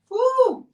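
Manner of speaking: bright cheering whoop; a quick high cheer of excitement; excited high woo. Real, not acted. Cheering about treading water.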